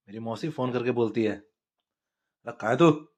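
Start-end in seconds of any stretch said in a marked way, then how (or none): 1.4-3.1 loud, slow, drawn-out auntie call; lightly voiced nosy aunt calling out; mock auntie shouting down the line; aunt on phone.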